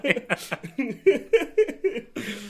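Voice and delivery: high laughter; laughing. Real, not acted.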